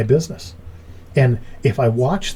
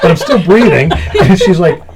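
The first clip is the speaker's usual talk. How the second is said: laughing; 6 semitones higher, 8 dB louder than usual. Retold or imitated. Retold sick joke with laugh.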